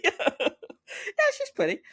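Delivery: very high, squeaky giggling repeat; laughing squeal. Retold or imitated. Pam teasing Doc about the friend, laughing squeal.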